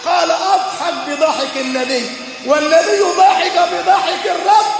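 high-pitched, ringing sermon delivery; impassioned preaching. Real, not acted. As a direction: preach it with rising fervour.

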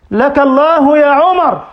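loud high cry of لك الله يا عمر; fervent cry. Acted. Preacher crying out to Umar in admiration, loud. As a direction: call out his name with grief. Emotional refrain.

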